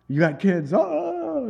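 high, long "oh" of surprise; mock surprised delight. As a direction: imitate someone thrilled by the news. Retold man's surprised reaction to kids.

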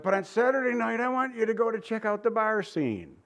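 high, sly coaxing tempter; the enemy luring a churchgoer, pitched high; tempting enemy voice. Retold or imitated. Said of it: "he says".

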